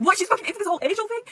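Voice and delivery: high, loud disbelief; astonished reaction. Retold or imitated. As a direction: blurt it out incredulously.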